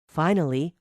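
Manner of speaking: plain reading voice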